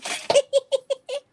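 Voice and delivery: high, loud burst of giggles; real giggling laugh, loud and high. Real, not acted. Listener cracking up at the near fall, high loud laughter. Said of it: "You're laughing with me".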